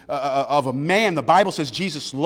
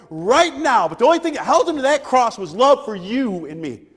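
loud, rising preacher cadence; preaching at full force; 6 semitones higher, 4 dB louder than usual. Acted. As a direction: preach it out to the church, pushing hard. Preaching climax on the cross.

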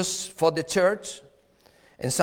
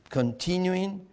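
ordinary talk

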